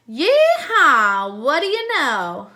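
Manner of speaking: loud, high whooping cowboy. A narrator's reading. Concession man greets customers, loud high cowboy whoop. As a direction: holler it like a wannabe cowboy.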